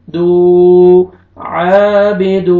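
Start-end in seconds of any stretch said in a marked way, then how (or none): 1.4-2.6 आबिदू with the दू vowel held long, very slow; आबिदू recited slowly, the final दू lengthened; stretched du. Shown as a demonstration. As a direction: draw out the दू of आबिदू. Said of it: "पेश दाल को आपने खींचना है".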